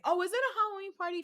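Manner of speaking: high, fast mock double-take; mock surprise. She quips in mock surprise at the witchy outfits. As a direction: act baffled by everyone's costumes.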